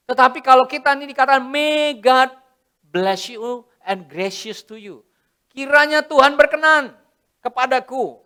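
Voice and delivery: loud, high preaching build. Acted. Preacher rousing the congregation, loud and high.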